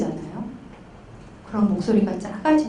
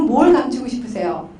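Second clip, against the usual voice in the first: normal talking voice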